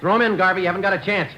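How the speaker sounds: shouted order. Acted. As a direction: yell it at the armed man. Cop shouting at armed suspect.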